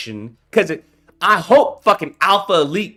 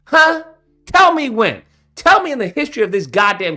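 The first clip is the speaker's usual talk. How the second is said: higher and louder, demanding an answer; demanding shout; 5 semitones higher, 4 dB louder than usual. Real, not acted. Ranting vlogger shouts a challenge at his viewers. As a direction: bark the challenge at them.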